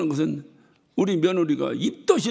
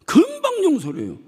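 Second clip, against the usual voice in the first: 금방 용서를 해요 cried out much higher and louder; emphatic repeat; 12 semitones higher, 5 dB louder than usual. Shown as a demonstration. Pastor hammers the point to the congregation, shouting. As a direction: shout the repeat to drive it home.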